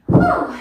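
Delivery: big high relieved whew; loud exhale. Real, not acted. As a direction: blow out a loud winded breath. End of the final exercise round.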